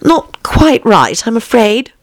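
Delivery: high, hesitant little voice; timid Alice. A narrator's reading. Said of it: "said Alice timidly".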